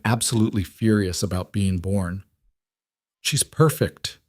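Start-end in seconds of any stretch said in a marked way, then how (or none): 3.2-4.2 breathed in awe. A narrator's reading. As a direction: say it in hushed wonder, pitched up. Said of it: "Rowan breathed".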